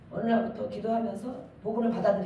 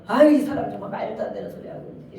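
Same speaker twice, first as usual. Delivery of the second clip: loud, scornful brush-off of nonsense; scoffing retort; 6 dB louder than usual. Retold or imitated. Preacher mimics people brushing off nonsense, loud and scornful. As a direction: scoff at someone's ridiculous talk. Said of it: "이렇게 하잖아요".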